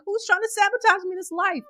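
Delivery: squeaky accusing voice; high mock outrage. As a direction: cry out like someone's been poisoned.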